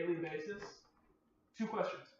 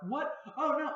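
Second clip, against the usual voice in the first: much higher, louder mock panic; imitating panic; 10 semitones higher, 5 dB louder, slower than usual. Retelling the friend's panic in a store, mock-alarmed. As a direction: squeak out a friend's alarmed gasp. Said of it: "her friend was freaking out, panicking".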